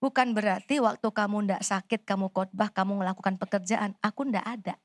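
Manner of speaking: a hint of God's quiet voice. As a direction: quote God's words gently and low. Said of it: "Tuhan kasih pewahyuan sama saya".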